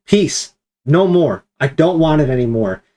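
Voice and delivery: a light take on Jesus ordering the storm; authoritative command. Retold or imitated. Jesus rebuking the wind, lightly voiced commanding tone. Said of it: "that authoritative command".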